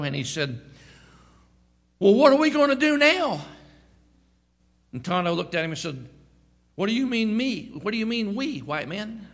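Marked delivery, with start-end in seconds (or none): 2.0-3.4 high, loud panicked cry; panicked Lone Ranger. Acted. 6.7-9.3 a raised, indignant comeback; Tonto's retort. Acted. Tonto's punchline reply.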